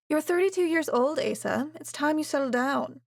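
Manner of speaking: mother pressing her son to settle down; nagging mother. A narrator's reading. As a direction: lecture him like a meddling mother. Mother nags son to marry.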